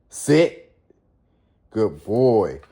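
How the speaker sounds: sharp loud command to the dog; firm command. Real, not acted. Owner ordering his dog to sit, loud and firm. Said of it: "that's how you gotta have your dog trained".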